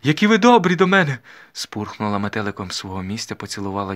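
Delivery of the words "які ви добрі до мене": high, loud gush of gratitude; overjoyed young lady thanking her uncle; flustered delighted young lady. A narrator's reading. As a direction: exclaim your thanks way up high. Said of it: "зашарілася панночка".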